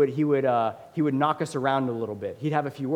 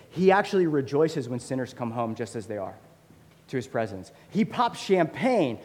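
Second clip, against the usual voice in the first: ordinary delivery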